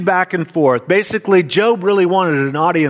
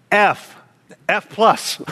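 ordinary delivery

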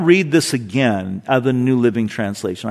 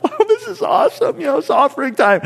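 laughing, much higher, quick; giddy laughing giver, high and fast; laughing delight; 14 semitones higher, faster than usual. Retold or imitated. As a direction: gush with laughter like a thrilled giver. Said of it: "somebody just laughing their head off".

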